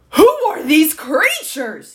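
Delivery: slow, loud, high outburst; bellow. A narrator's reading. Duke staring at giraffe and monkey, bellowing. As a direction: bellow it out in outrage. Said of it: "he bellowed".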